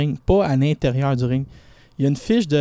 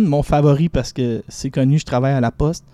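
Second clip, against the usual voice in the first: ordinary talk, nothing marked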